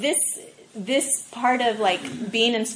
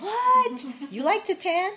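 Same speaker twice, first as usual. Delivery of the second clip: mock shocked, much higher pitch; shocked friends; 11 semitones higher than usual. Friends baffled that she loves tanning, high shocked squeal. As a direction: squeal it like astonished girlfriends. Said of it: "They're like".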